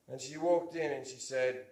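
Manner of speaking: usual speaking voice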